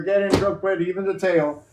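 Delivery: normal talking voice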